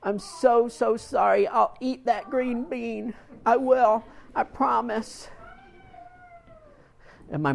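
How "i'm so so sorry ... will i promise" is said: put-on tearful boy. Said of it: "And I said".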